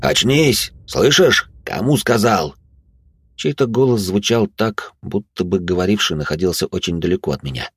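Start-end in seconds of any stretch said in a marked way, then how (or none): 0.0-2.6 loud, urgent call to wake; shouting. Acted. Rousing an unconscious prisoner.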